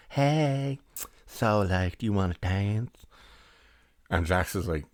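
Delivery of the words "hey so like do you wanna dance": drawn-out come-on, opening high; ultra-feminine flirt. Retold or imitated. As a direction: put on a hammed-up effeminate chat-up voice. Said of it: "ultra feminine".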